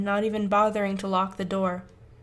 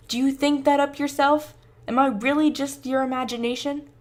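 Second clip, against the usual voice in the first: louder, higher heated questions; angry pressing; 5 semitones higher, 6 dB louder than usual. Acted. Josh pressing Tyler angrily, louder and higher. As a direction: press him hard.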